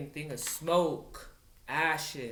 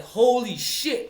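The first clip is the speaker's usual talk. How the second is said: drawn-out, loud, higher exclamation; retold shock; 7 semitones higher, 8 dB louder, slower than usual. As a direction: act out a stunned inner reaction, stretched out. Retold inner shock while driving.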